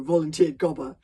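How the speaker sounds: usual speaking voice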